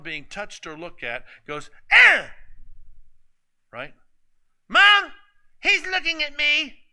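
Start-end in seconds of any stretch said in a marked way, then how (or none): 1.9-2.3 put-on shrill child's squeal, very high; whiny protest squeal. Child protesting sibling's look. 4.7-6.8 put-on high, loud whining kid voice; whiny tattling little sibling.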